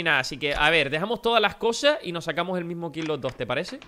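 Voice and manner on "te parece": normal talking voice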